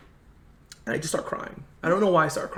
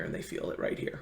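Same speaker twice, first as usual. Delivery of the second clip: hushed; 12 dB quieter than usual. Retold or imitated. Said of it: "He's like".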